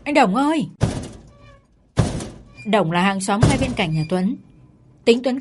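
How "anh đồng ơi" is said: yelling for the neighbour, high and loud. A narrator's reading. Man yells for the neighbour to open up. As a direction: bellow at the gate in the rain. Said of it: "Tuấn vừa gào lớn lên".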